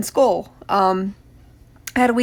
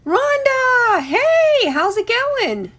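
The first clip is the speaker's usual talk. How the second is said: much higher and louder, the name stretched out; excited greeting; 12 semitones higher, 5 dB louder, much slower than usual. Real, not acted. Host welcoming a favourite viewer, high gleeful greeting. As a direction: squeal the name with joy.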